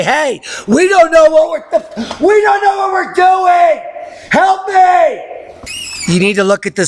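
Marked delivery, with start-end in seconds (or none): 0.0-5.2 mock panicked forecasters. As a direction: wail it like helpless experts begging for rescue. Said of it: "wouldn't you say".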